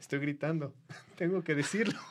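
imagined reply. Retold or imitated. Comic imagining his friend answering his own thoughts, understated mock reply. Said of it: "y tú".

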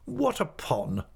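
high, slow, sharp demand. A narrator's reading. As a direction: cut in as a severe aunt. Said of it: "said my aunt sharply".

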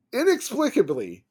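high-pitched disbelief, falling away; incredulous. Real, not acted. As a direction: start high as if baffled, then drop. Incredulous at coach's behaviour.

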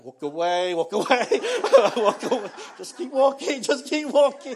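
giggling, rising push to keep walking; laughing through the words, urging the family onward; mock-panicked, laughing escape. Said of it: "and I'll go".

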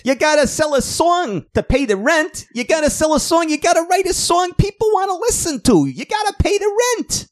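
landlady voice. Retold or imitated. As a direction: nag him about the rent up high. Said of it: "That's what she says".

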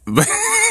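sudden high squeal of laughter. Real, not acted. Dad laughing about his daughter's choice, high squeal. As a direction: burst out laughing.

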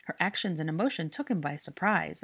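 ordinary delivery

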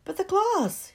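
high, loud disbelief; stunned, can't believe it; shocked. Acted. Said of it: "was in shock".

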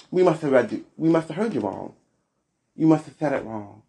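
usual speaking voice

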